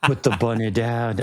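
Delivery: imitating Nick Cage's Southern accent. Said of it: "doing this weird Southern accent".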